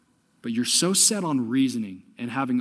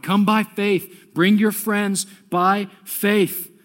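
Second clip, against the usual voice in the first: slow, emphatic preacher's call; slow urging; 4 semitones higher, 4 dB louder, much slower than usual. Acted. Preacher urging the church to bring friends, slow and emphatic. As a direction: drag each word out, pleading.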